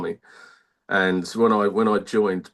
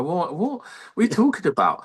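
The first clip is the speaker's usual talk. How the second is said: baffled; 10 semitones higher than usual. Retold or imitated. Retold confusion at girls' question.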